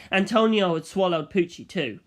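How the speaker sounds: usual speaking voice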